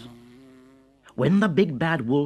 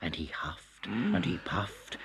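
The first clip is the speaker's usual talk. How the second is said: whispered huff; 5 semitones lower, 7 dB quieter than usual. A narrator's reading.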